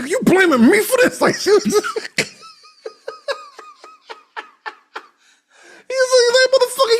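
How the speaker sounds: high, fast laughing squeal; laughing mock outrage. Imitating Grace yelling at her husband in a high laughing squeal.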